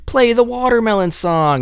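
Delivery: put-on high-pitched Southern-accented crowd call; Southern bar heckler. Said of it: "doing all those crazy Southern accents".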